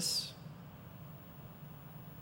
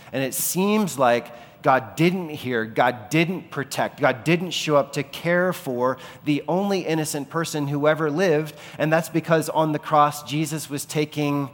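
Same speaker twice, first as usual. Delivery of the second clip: preaching at full force; 4 semitones higher, 6 dB louder than usual. Acted. Preaching God's silence at the cross.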